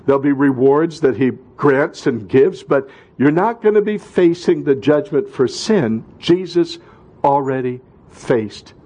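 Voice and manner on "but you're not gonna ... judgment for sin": usual speaking voice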